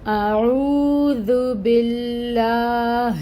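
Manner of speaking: slow, drawn-out melodic Quranic chant; Quran recitation. Acted. Teacher reciting the verse for students, slow melodic Quranic chant. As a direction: recite each word long and measured, like tajweed.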